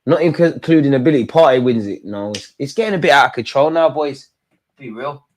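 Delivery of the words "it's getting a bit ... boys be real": ordinary talk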